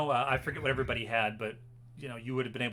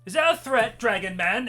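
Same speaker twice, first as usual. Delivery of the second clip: much higher and much louder threatening challenge; menacing guard; 9 semitones higher, 11 dB louder than usual. Acted. Guard threatens the dragonborn prisoner, loud angry challenge. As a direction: shout the challenge rattling the cage. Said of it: "rattling it on the cage".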